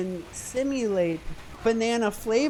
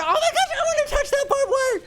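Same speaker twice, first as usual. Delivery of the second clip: excited squeal; 5 dB louder, faster than usual. Pretend. Goofy excitement over barbed wire.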